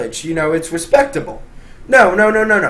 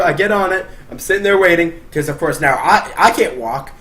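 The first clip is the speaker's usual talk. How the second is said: normal talking voice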